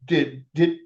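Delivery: high-pitched, stammered amazement; stunned disbelief. Retold or imitated. Onlookers react to the one-arm lift, stammering disbelief. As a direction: imitate a crowd that can't believe it, stretching the words.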